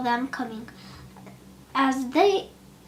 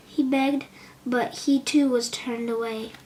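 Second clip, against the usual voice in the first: ordinary delivery, nothing marked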